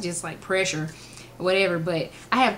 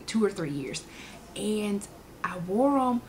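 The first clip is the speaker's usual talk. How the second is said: ordinary delivery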